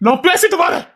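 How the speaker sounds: high, mocking repeat of நான் பேசிட்டு வரேன்; mocking echo. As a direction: parrot her words back at her, teasing.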